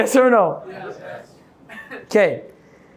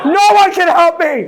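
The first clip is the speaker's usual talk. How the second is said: mock self-pity; 7 semitones higher, 5 dB louder than usual. Mocking a hopeless negative person.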